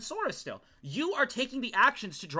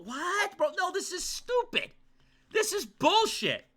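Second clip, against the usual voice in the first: high-pitched indignant protest; incredulous outburst; outraged disbelief; 12 semitones higher, slower than usual. Real, not acted. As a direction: cry foul, voice jumping way up. Outraged at the game's ruling.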